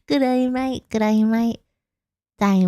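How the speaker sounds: normal talking voice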